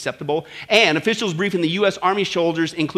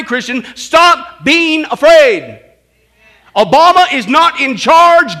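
loud, high, building refrain with shouts; full-force preaching; 8 semitones higher, 13 dB louder than usual. Real, not acted. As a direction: thunder it over the congregation.

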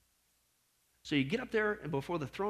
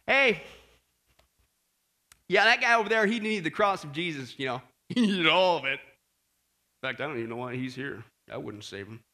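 a hint of arrogant scorn, louder at first then sinking low; lightly voiced smug sinner sneering at others; arrogant attitude; 4 semitones lower, 4 dB louder than usual. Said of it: "with an arrogant attitude and literally say".

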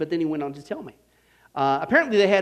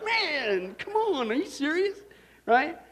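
high, incredulous come on, half laughing; can't believe it, still chuckling; higher, amazed are you serious; laughing disbelief; 9 semitones higher, slower than usual. Real, not acted.

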